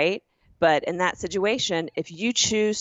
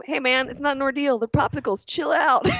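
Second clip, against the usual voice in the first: incredulous brush-off, pitched up; exasperated, higher; 8 semitones higher than usual. Retold or imitated. Customer brushing off the agent's fuss, incredulous and high. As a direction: laugh it off in disbelief. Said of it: "I go".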